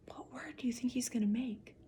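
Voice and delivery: soft unvoiced whisper. Reader whispering to the listening children, hushed. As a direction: lean in and whisper the question.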